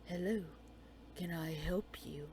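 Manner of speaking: low, hushed whisper of his greeting; imitating William. [name] recalling William's first words, hushed low whisper. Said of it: "All you said was".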